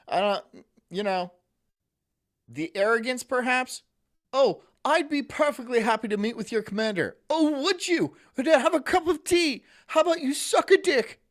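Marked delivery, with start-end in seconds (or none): 4.3-7.1 higher-pitched, sneering British imitation; over-polite mock British accent. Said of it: "your fucking stupid British accent". 7.3-11.2 loud, high-pitched sarcastic tirade; hammed-up indignant retort; mock outrage. Mock quarrel with the British man.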